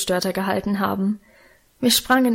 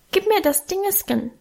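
put-on little boy's high-pitched plea; high little child's voice; 9 semitones higher than usual. A narrator's reading. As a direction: ask in a small high toddler voice. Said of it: "bat mit seinem hohen Stimmchen". (mit hohem Kinderstimmchen bittend)

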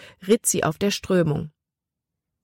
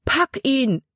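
high, urgent call; 9 semitones higher than usual. A narrator's reading. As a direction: tell her to grab it. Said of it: "rief nochmals".

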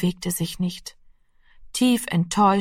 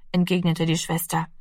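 plain reading voice

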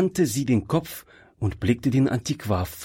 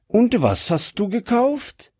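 high-pitched inquisitive question, rising at the end; curious; 12 semitones higher than usual. A narrator's reading. Wife asking husband about his purchase, curious. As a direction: ask with keen curiosity, voice lifted high. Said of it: "fragte neugierig seine Frau".

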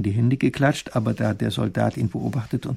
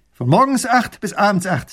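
higher, louder proud declaration; 8 semitones higher, 6 dB louder than usual. Acted. Soldier proudly states his hours, higher and louder. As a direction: announce it with pride. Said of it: "sagt der Mann stolz".

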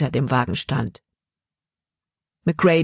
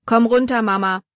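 higher, louder call to the wagon; 7 semitones higher, 3 dB louder than usual. A narrator's reading. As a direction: call out to mother inside. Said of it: "rief Jordan in den Wagen".

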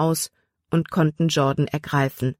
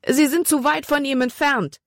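high-pitched voice of alarm; horror-filled; 9 semitones higher than usual. A narrator's reading. Caleb sees rescuers too far away, horror-filled cry. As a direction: despair aloud, high and frightened. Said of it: "Calebs Stimme war schreckerfüllt".

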